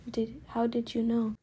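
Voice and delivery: plain reading voice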